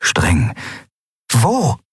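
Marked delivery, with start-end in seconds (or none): 1.3-1.8 short, high-pitched demand; stern one-word question. A narrator's reading. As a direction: ask it sharply, as an order. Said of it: "war fordernd, streng".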